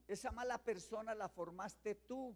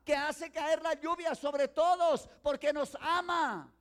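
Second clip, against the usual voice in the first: loud, slow, ringing sermon; fiery preaching; 6 semitones higher, 10 dB louder, slower than usual. Acted. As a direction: preach it slowly and powerfully to the congregation. Preaching at full force. (predicación lenta y fuerte, proclamando)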